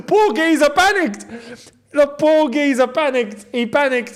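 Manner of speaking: high-pitched, laughing outburst; tickled, high-voiced match reaction; amused exclamation. Real, not acted. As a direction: burst out laughing, then crow about the blunder. Laughing at a panicked player.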